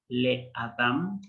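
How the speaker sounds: "le Adam" said slowly, each syllable clear; slow Hebrew word. Shown as a demonstration. Teacher modelling Hebrew "le Adam" slowly for students. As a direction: spell out "le Adam" slowly for the class. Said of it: "Por ejemplo".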